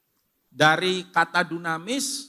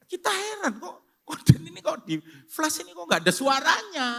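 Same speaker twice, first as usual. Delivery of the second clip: amazed friends; 13 semitones higher, 4 dB louder than usual. Retold or imitated. Friends' amazed remark, retold.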